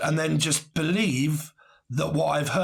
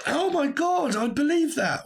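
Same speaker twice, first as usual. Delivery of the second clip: much higher, hammed-up awe; mock gullible gasp; 13 semitones higher than usual. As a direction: squeak it like a credulous fool. Mocking gullible believer.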